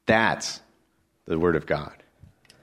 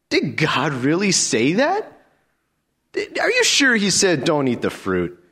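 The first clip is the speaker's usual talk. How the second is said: much higher, sneering question; mocking serpent; 8 semitones higher than usual. Preacher voicing Satan mocking God's command, much higher. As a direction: taunt with rising disbelief. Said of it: "That's how Satan makes you doubt".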